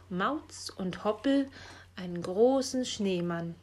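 plain reading voice

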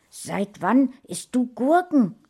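can't believe what she sees; astonished question. A narrator's reading. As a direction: sister amazed her brother eats cucumbers. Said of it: "fragte sie erstaunt".

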